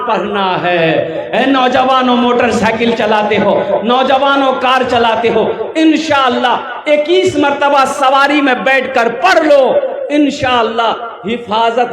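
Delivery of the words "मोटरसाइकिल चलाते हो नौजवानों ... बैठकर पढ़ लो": ordinary talk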